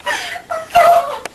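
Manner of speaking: high wheezing laugh; helpless laugh. Real, not acted. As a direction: laugh until you can barely breathe. Laughing fit.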